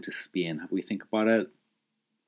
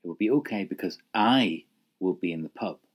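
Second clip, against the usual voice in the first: emphatic "I" mid-sentence; stress on I. Shown as a demonstration. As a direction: lean on "I" after "because". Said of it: "the emphasis on the I".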